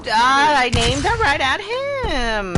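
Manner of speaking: high, loud and slow gaming yell; excited yell. Real, not acted. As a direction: shout it out in disbelief. Excited outburst during match.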